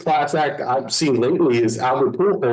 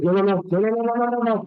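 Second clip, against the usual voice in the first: frantic no; 9 semitones higher, faster than usual. Retold or imitated. Coach sees runner about to steal.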